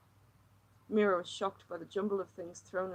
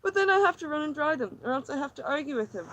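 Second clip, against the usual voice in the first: higher, tense, pleading woman; distressed woman; 5 semitones higher, 9 dB louder than usual. Acted. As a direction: pour out her misery, near breaking. Lily's agitated monologue.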